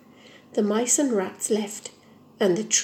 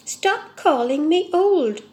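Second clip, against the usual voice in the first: slow, louder and higher, offended protest; indignant; 5 semitones higher, 5 dB louder, slower than usual. Acted. Tree scolds the mice for calling him old, indignant. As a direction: bristle at being called old. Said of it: "said the fir tree indignantly".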